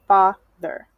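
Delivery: "father" slowly, FA stressed, ther unstressed; slow FA-ther in two parts; stress on first syllable. Shown as a demonstration. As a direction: lean on FA, let ther drop in "father". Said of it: "and the unstressed above the E R".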